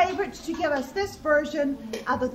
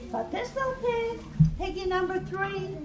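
usual speaking voice

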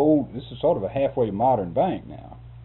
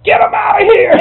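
a full, much louder and higher yell; 19 dB louder than usual. Retold or imitated. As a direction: yell so it echoes off the bank walls. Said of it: "He yelled".

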